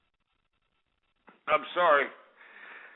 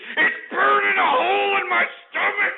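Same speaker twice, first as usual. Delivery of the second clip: anguished shout; 8 semitones higher than usual. Real, not acted. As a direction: yell it like it's killing you. Ranting radio host losing it.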